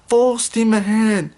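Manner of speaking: put-on a boy calling the train onward. A narrator's reading. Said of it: "called out Dudley".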